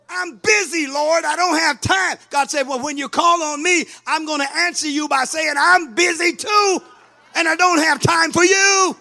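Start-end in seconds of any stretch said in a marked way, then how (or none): 0.1-2.2 loud, high whining excuse; mock excuse to God. 2.7-8.9 loud, high and building to a shout; God throws the excuse back, shouting; God's stern reply. Retold or imitated.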